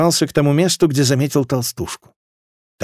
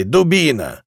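growled insult from a townsman; angry growl; slower than usual. A narrator's reading. Fat man insulting someone in the crowd, growling. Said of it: "прорычал какой-то толстяк".

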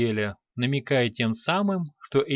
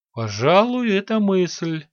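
higher-pitched, thoughtful assent; mildly pondering, then agreeing; considered agreement; 5 semitones higher, 3 dB louder than usual. A narrator's reading. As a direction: agree as if just won over. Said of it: "немного подумав, согласился Санти".

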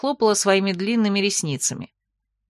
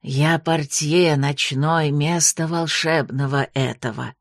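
low, slow, quirky creature voice; odd night porter voice; 5 semitones lower, slower than usual. A narrator's reading. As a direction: drawl the compliments oddly. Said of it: "в своей чудной манере".

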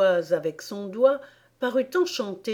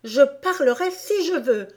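high, louder retort; defiant Alice; 7 semitones higher, 5 dB louder than usual. A narrator's reading. Alice refusing the queen's order to be silent, defiant. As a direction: answer back with raised voice.

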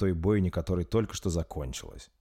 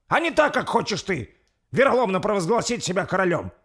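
high, raised indignant declaration; forceful demand; 11 semitones higher, 5 dB louder than usual. Acted. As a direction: demand your rights before the hall. Лорд требует собрать совет. (требовательно, возмущённо)

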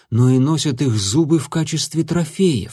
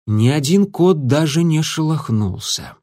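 usual speaking voice

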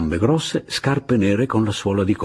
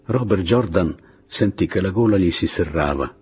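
ordinary delivery

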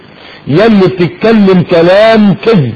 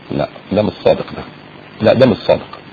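muttered refusal; 7 semitones lower, 7 dB quieter than usual. Acted. Voicing inner refusal of liar.

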